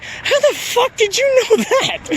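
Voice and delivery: slow, high-pitched disbelief; high incredulous squeal. Retold or imitated. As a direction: stretch it out, squeaky and stunned. Retold baffled question, high voice.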